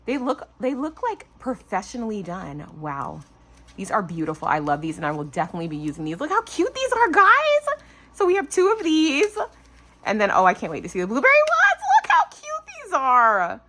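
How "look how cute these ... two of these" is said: 1 excited squeal. Real, not acted. Gushing to viewers.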